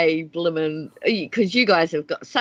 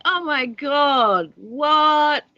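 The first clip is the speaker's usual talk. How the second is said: mock American teen; 7 semitones higher, 3 dB louder, much slower than usual. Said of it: "it's like".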